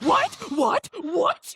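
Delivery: high, rising disbelieving whats; each what a squeal of disbelief; stunned whats. Retold or imitated. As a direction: fire them off in shock. Repeated disbelief at info dump.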